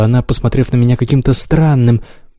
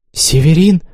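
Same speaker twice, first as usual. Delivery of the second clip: higher-pitched cry of fury and horror; startled outcry, pitched up; alarmed exclamation; 4 semitones higher than usual. A narrator's reading. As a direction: call the name out in alarm. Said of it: "воскликнула она".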